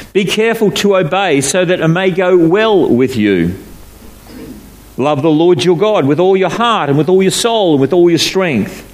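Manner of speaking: scripture reading. Acted. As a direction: read the verses out to the congregation. Reads scripture passage aloud.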